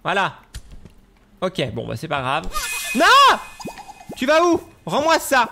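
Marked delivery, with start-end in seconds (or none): 3.0-4.6 high, loud yelling after a thief; furious shout. Real, not acted. Le raton vole quelque chose. 4.8-5.5 loud, high demand; angry shout. Real, not acted. Ordre répété.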